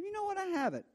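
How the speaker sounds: high, sudden dawning surprise; hammed-up oh-I-forgot; king caught off guard, lightly played; mock realization.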